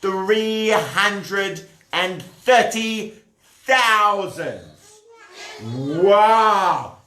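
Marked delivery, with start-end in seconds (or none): none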